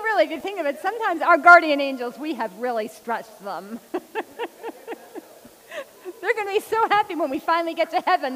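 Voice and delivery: usual speaking voice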